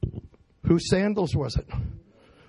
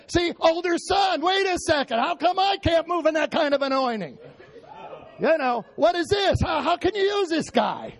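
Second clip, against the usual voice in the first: mock older-son outrage; 10 semitones higher than usual. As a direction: shout it like a jealous brother. Preacher acts the older son.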